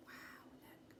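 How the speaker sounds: breathless whisper of awe; whispered wonder. Acted. Narrator whispering to the children in awe. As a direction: hush them in, amazed.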